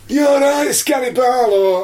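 high, shouted jeer; mock shout. Host voicing UK fans jeering at Gary. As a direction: yell it as a mocking fan. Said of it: "they said".

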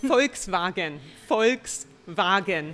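Volkswagen with F at the start and V for the W; German pronunciation. Shown as a demonstration. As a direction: demonstrate Volkswagen as FOLKS-vah-gen, second time slowly. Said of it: "Very good".

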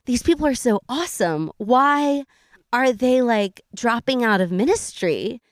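high, wide-eyed wondering voice; mock naive admiration. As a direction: play innocent, puzzled admiration. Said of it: "And we were like".

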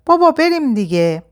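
put-on high-pitched little boy voice. A narrator's reading. Boy calling dad from car window, high child voice. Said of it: "پسر بچهٔ هفت هشت سالهٔ توپلی".

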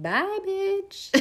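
high sassy goodbye; sassy brush-off. Retold or imitated. Running from betrayal, high sassy brush-off. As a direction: fling it off up high. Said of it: "I'd be like".

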